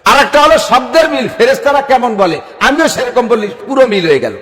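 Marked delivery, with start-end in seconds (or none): none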